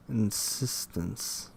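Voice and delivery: normal talking voice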